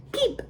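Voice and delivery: high little beep; truck beep. A narrator's reading. Reading Little Blue's line, a high beep. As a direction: beep like a toy horn. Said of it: "said Blue".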